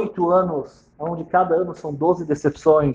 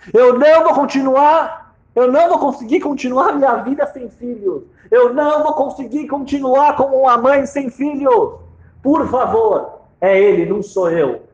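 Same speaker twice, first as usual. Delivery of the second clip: desperate plea; 6 semitones higher, 4 dB louder than usual. Acted. Wife begging her husband to save the child. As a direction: beg with everything left.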